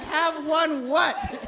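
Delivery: high, loud, very slow disbelief; stunned counselor asking the patient, incredulous. Retold or imitated. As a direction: stretch it out in disbelief. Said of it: "I was like".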